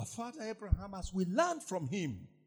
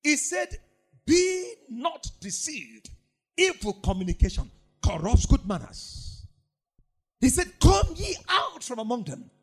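louder, higher, driving pulpit delivery; full-force preaching; 4 semitones higher, 5 dB louder than usual. Acted. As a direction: preach it hard at the congregation.

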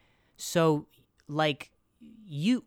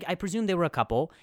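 normal talking voice

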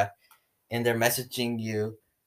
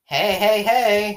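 put-on high, loud Valley girl heys; Valley girl accent; 8 semitones higher, 5 dB louder, much slower than usual. Girl playing the accent for friend, Valley girl accent. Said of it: "Sometimes I'll do that accent".

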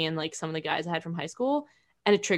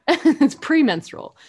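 slow careful correction; 8 semitones higher, 7 dB louder, slower than usual. Real, not acted.

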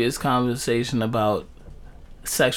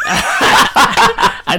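laughing; 5 semitones higher, 5 dB louder than usual. Real, not acted. Laughing confession.

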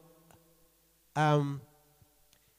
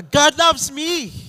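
loud, high, slow declaration; with conviction; 11 semitones higher, 12 dB louder, slower than usual. Shown as a demonstration. Preacher leading the congregation, loud and convinced. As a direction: proclaim it with full conviction. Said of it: "Say it with conviction".

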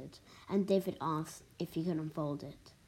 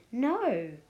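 raised, forceful denial; firm, higher-pitched refusal; 5 semitones higher, 4 dB louder than usual. A narrator's reading. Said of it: "with real force".

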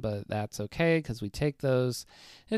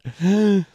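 sharp gasp; 9 semitones higher, 9 dB louder than usual. Pretend. Mock shock at own advice.

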